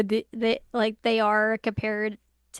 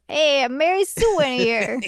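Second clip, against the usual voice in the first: slow, hammed-up New York voice; playful New York street accent; 3 semitones higher, slower than usual. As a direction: do a mock New Yorker, stretching the words. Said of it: "like, a New York voice of".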